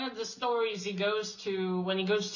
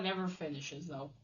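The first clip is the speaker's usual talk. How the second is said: ordinary delivery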